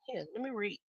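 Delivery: usual speaking voice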